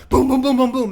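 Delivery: loud booming stomps; loud imitated booming crashes; pounding booms. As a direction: pound out each boom like heavy machinery. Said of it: "this big stomping, tearing, crashing sound".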